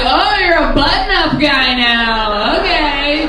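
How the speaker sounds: slow, drawn-out sarcasm; mock snide. Jilted ex reacting to a photo, slow sarcasm. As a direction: stretch it out in mock approval. Mocks ex's new shirt.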